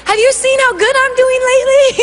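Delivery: high, quick boast collapsing into a laugh; nervous laugh. Acted. Woman dodging Jesus's question, nervously laughing. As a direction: overeager question with an acted nervous giggle. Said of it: "The nervous laugh".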